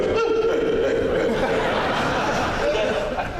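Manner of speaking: high breathy cartoon chuckle; Hibbert's chuckle. Shown as a demonstration. Voice actor shows off his character's laugh, high chuckle. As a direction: do the doctor's signature giggle. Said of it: "Doctor Hibbert".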